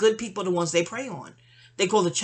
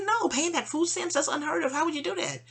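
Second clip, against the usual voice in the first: raised pitch, amazed it's even asked; higher mock incredulous voice; 9 semitones higher than usual. Retelling what immigrants told her, higher and incredulous. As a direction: play the friend, disbelieving, pitched up.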